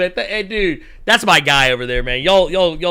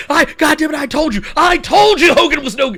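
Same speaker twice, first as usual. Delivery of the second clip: high, loud mock outrage; going off; 9 semitones higher, 5 dB louder than usual. Imitating the commentator after Hogan's betrayal, going off. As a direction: rant an I-told-you-so. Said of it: "he was going off that night".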